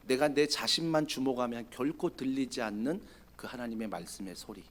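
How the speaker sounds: still small voice. Acted. God speaking to Elijah in a hushed still voice. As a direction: speak like silence itself.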